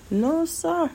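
a sassy high "No, sir"; mock-polite high dismissal; sassy refusal. Real, not acted. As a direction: brush him off with a sharp high squeal. Dismissing ex who ghosted her.

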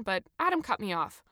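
ordinary delivery

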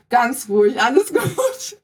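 laughing, rising to a high pitch; retold reassurance. Soaked athlete retells reassuring Hans-Jörg, laughing and high-pitched at the end. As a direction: play down a mishap through giggles, ending high. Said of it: "ich bin so zu ihm hingegangen".

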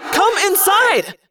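high, drawn-out shouted order; urgent yell. A narrator's reading. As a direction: yell him in, stretching the words. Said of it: "I yelled".

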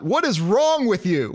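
lightly Southern exasperated robot; Southern accent. Retold or imitated. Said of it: "you gotta say it in the right accent".

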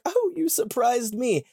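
put-on a touch of a surprised little girl; mild Lucy quote. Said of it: "she's like".

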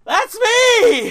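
very high, squealed shout of triumph; excited shout. Shown as a demonstration. Raffle winner claiming the final number, shrieking with excitement. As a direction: scream it as the winner. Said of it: "I say".

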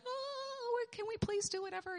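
mock pleading. Travellers begging the wizard, high timid mock plea. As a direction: beg timidly like a frightened traveller.

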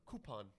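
soft "Coupon" said KOO-pon; "Coupon" starting like coo, no y. Shown as a demonstration. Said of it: "not a coupon".

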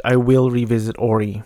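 ordinary talk, nothing marked

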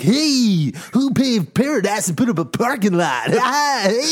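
high, whooping mock singer voice. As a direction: call it out high, hammed up. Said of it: "if you go to a Counting Crows show, they're like".

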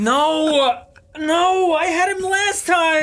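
high, drawn-out cry of dread; mock anguished wail. Cleaning crew member hearing Deshaun returns, high anguished wail.

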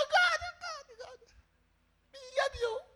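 very high mock crying; hammed-up tearful cry; wailing penitent. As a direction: wail it like a desperate sinner. Acts out sinner crying to God.

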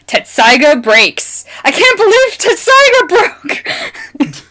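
slow, climbing to a high squeal; mock amazed fan. Podcaster re-enacting an anime Vine, mock amazement. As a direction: imitate an overdramatic anime fan, stretched and high. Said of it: "it's literally like".

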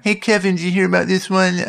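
a bad Leno that slides into Capote; high, fey talk-show host voice; Jay Leno as Truman Capote. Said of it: "terrible Jay Leno impression that sounded like Truman Capote".

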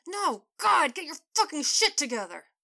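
high loud anguished yell at the team; despairing yell. Acted. Fan yells in despair at his team's bad play, high and loud. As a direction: scream your frustration at the screen. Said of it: "he heard a despairing yell".

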